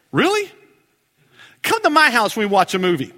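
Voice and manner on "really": much higher mock disbelief; sharp rising really; incredulous. Real, not acted. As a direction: scoff at the claim. Incredulous reply to claim.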